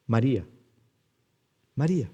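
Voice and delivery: soft, gentle calling of a name; very tender. Acted. Jesus calling grieving Mary by name, tenderly. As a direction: call the name softly, with tenderness. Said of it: "de la manera más tierna".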